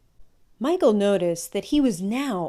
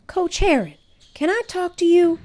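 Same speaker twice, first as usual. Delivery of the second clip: put-on boy's voice; 11 semitones higher, 5 dB louder than usual. Nervous boy addressing coach.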